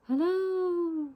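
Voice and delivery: a high, faint hello, like it comes from far off; distant echo. Acted. The mountain echoing the child's hello back, faint and distant. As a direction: let it sound like your own voice bounced back from afar. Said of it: "I heard a voice call back".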